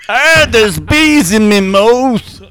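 slow, high, hammed-up yell; Ugandan warlord swallowing bees. Warlord with a mouth full of bees, yelling. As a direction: holler it in a mock Ugandan accent. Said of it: "who was actively swallowing bees".

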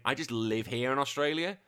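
ordinary delivery

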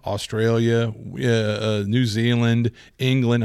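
ordinary talk

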